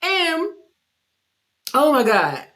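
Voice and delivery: usual speaking voice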